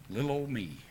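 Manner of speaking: put-on a soft, low, humble little old me with weight on Little; a preacher, humbled, speaks gently of God's care. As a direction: hush down and say it humbly. Tender turn after feeling tiny.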